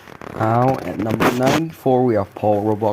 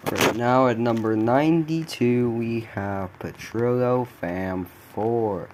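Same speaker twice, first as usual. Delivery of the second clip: normal talking voice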